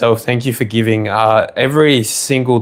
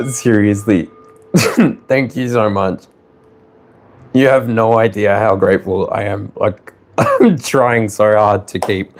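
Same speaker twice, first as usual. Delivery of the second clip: ordinary talk, nothing marked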